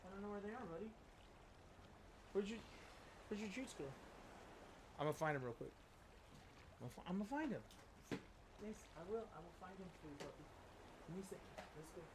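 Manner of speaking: soft, away from the mic; talking to the dog. Real, not acted. Owner hunting for his dog's treats, quiet pet talk. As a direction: quietly reassure a pet from across the room. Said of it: "if I talked to Kit like that".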